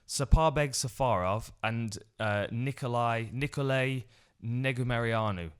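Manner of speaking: slow, deliberate read of the tricky names; careful slow naming. Shown as a demonstration. Announcer reading fighter names carefully, slow. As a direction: take each syllable carefully. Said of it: "I nailed it first time".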